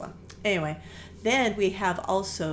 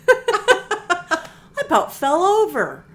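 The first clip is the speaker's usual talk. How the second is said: laughing through it, much higher and louder; 13 semitones higher, 13 dB louder, much slower than usual. Real, not acted. Owner recalling the free magazine offer, laughing. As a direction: laugh in delighted disbelief.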